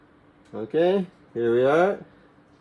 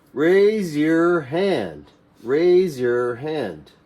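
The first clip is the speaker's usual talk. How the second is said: "Raise your hand" drawn out and stressed on raise; slow drill; 3 semitones higher, much slower than usual. Shown as a demonstration. ESL teacher drilling a command, slowly. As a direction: model it slowly for the class. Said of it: "slow drilled repetition".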